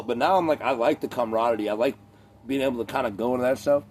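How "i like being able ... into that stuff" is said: ordinary talk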